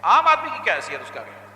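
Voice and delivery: usual speaking voice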